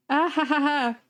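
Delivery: high, spelled-out fake laugh into a reply; acted awkward laugh. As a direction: play along with a put-on ha-ha-ha. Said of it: "I was laughing".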